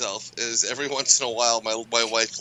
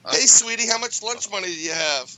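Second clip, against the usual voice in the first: mock streetwalker come-on; 8 semitones higher, 4 dB louder than usual. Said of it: "all the cross dressers are out there".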